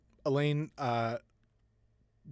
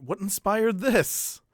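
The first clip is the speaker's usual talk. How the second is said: feigned interest; 8 semitones higher, 6 dB louder, much slower than usual. Acted. NPC asks in feigned politeness.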